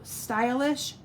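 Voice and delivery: slow, careful Stylish Shapes, each word drawn out; deliberately slow product name; said slowly. Shown as a demonstration. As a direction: spell out Stylish Shapes slowly so it comes out right. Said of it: "I gotta say it slow".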